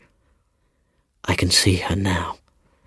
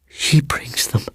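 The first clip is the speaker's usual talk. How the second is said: breathy whisper; 5 semitones higher, slower than usual. A narrator's reading. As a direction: let the words fall to a whisper. Seaton whispering.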